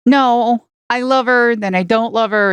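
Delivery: ordinary talk, nothing marked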